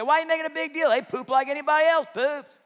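put-on high, loud incredulous dad to his kids; mock exasperated parent. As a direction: scold the kids for fussing over stars.

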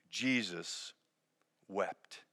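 softly, letting each word fall; a hushed, grave pause between the words; solemn hush. Acted. Pastor reading the shortest verse to grieving listeners, hushed. Refrain after the loud question.

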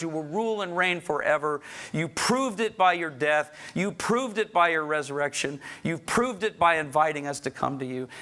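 high, ringing praise to the Lord; fervent prayer. Acted. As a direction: lift the prayer up with full voice.

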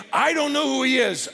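irritated denial. Acted. Peter denying Jesus a second time by the fire, irritated. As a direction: deny it with rising heat.

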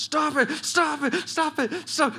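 put-on high squealing child breaking into laughter; mock helpless squeals as if being tickled; tickled protest. Son begging dad to stop tickling him, high laughing squeal.

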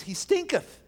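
lightly mock the old Bible line; King James quote. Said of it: "in the King James".

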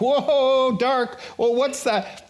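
high, loud startled cry; startled alarm. Acted. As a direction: act out a spooked double-take. Acting out startled rowers at sea.

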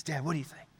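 soft, low question; hushed greenside voice; hushed son. Acted. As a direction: ask dad quietly on the green. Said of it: "everyone's quiet".

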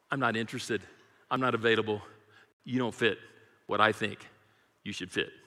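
low, soft, brushing Christ off; dismissive rejecter. Acted. Preacher voicing people who reject Christ, low and dismissive.

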